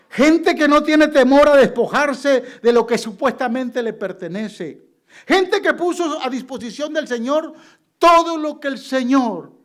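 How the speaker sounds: loud, high sermon climax; preaching at full force. Acted. A pastor exhorting the congregation at the climax, loud and high. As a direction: drive the point home to the congregation.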